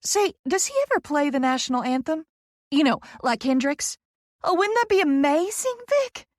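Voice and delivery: high gush rising to a squeal; near-squeal of excitement. A narrator's reading. Poppy dreaming up an anthem performance, squealing with excitement. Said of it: "She all but squeals with excitement".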